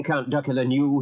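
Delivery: ordinary delivery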